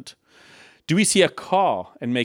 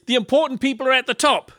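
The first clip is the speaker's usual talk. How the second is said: much higher mock proclamation; the world's proclamation; 9 semitones higher than usual. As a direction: announce it high, as the world's creed. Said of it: "The world says".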